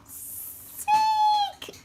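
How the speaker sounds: overjoyed little cry; thrilled. Acted. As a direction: sing out the word with glee. Gerald learns he is sick.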